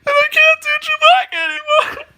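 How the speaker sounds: straining for a high wookiee growl; failed wookiee impression, high and strained; Chewbacca. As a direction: reach for a Chewbacca roar and miss. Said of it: "I used to do the best Chewbacca".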